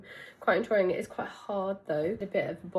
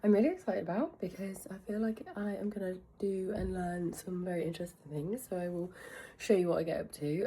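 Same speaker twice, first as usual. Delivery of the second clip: ordinary talk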